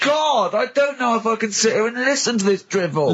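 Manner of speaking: can't stand another word of it; exasperated rant. Real, not acted. Friend fed up with Carl's nonsense, shouting high.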